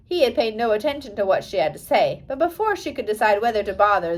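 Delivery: plain reading voice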